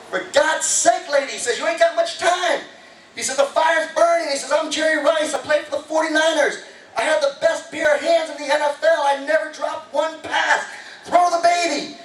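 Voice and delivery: high, urgent shouted pleading; Jerry yelling. Retold or imitated. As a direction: yell up to the lady, insistent. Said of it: "he yelled".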